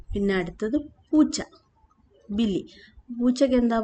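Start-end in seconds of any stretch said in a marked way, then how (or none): none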